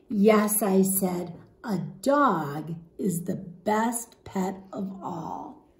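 lively, expressive storybook read-aloud; animated picture-book narration; reading to children. Acted. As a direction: read it to a class of young kids.

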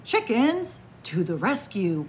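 usual speaking voice

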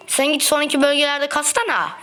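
ordinary talk

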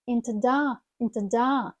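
"Into da" glued as "intuda"; linked words. Shown as a demonstration. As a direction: run "Into da" into one word. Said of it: "Також склеїмо це слово".

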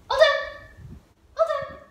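high sing-song release; release cue. Real, not acted. Trainer singing the release cue to her dog.

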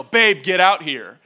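loud, slow, laughing yell; mock yell. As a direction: summon the husband in mock anger. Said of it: "I hear her yell".

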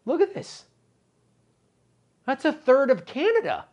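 shocked exclaim. Real, not acted. Shocked at map of Canada.

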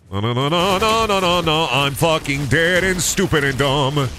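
real frustration at dying in the game; frustrated yelling. Real, not acted. Gamer dying mid-match, rising anguished wail.

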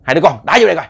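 loud, sharp angry command; angry order. Retold or imitated. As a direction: bark the order at his sons. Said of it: "cái cách nói chuyện của ổng cũng tức".

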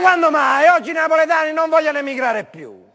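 high, loud indignant rebuttal; outraged comic denial; indignant protest. Acted. Indignant denial in sketch quarrel.

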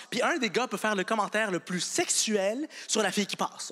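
normal talking voice

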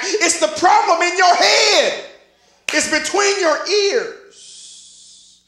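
forceful, emphatic sermon delivery; fired-up preaching. Real, not acted. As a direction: drive the scripture home to the congregation.

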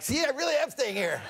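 high-pitched mock call from a balcony; calling across.

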